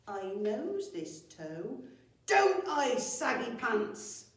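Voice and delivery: high, loud rustic roar; loud booming old woman. Acted. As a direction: bellow it at the stone, slow at the end. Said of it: "she roared".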